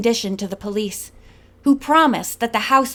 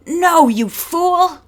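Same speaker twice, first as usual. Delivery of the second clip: high, loud, slow snap at him; barked; 7 semitones higher, 5 dB louder, slower than usual. A narrator's reading. Belle barking at Thorne to stop. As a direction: cut him down hard. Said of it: "barked Belle".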